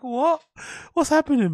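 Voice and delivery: very high, loud mock-distressed voice; acted bewilderment, shooting way up in pitch; bewildered squeal. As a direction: squeak it out in hammed-up confusion. Acting himself bewildered by dad's scolding.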